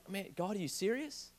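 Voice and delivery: a worn-out whine, barely voiced; tired complaint to God. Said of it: "I'm like".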